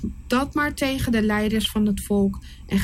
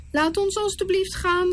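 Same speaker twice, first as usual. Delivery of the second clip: higher, imploring plea; pleading Aaron; 10 semitones higher than usual. Acted. Aaron begging Pharaoh to let the people go, pleading. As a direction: beg the king gently. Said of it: "smeekte Aaron".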